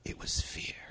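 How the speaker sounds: breathy whispered last line. Acted. The reader ends the passage on fear, in a whisper. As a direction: let the last words fall to a whisper.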